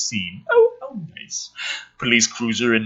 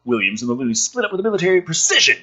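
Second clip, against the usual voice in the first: ordinary talk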